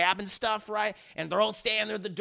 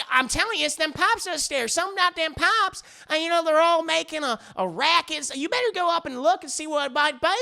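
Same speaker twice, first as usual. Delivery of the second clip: Bridget; 9 semitones higher, 4 dB louder than usual. Retold or imitated. Wife blames noise on pipes upstairs, high Southern accent. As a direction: insist urgently as a hammed-up Southern wife. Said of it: "She has a very deep Southern accent".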